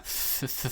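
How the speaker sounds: unvoiced th hiss; th sound. Shown as a demonstration. Teacher demonstrating the th sound. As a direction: breathe a soft th through the teeth. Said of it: "com esse som".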